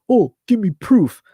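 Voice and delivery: high, loud mock demand; mock the people who want proof; mimicking doubters. Imitating doubters who demand proof, high and loud. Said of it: "they, they ask".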